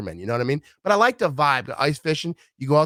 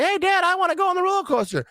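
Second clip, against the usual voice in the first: put-on much higher little kid voice. Little kids begging dad to ride the coaster, childlike voice. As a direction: beg like a small child. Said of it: "they're like".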